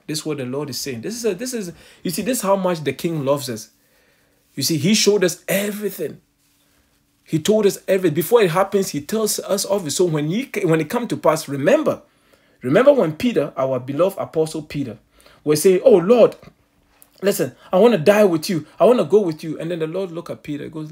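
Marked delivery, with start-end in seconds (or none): 1.0-15.8 loud, high, fervent preaching; preaching at full force. Acted. Preacher exhorting the congregation. 15.8-19.6 loud, high imploring cry; pleading Peter. Retold or imitated.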